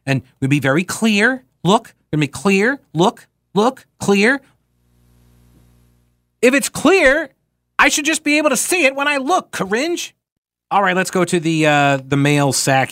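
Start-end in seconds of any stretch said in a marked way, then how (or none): 0.1-10.1 mocking the press secretary. Mocking her stock phrases.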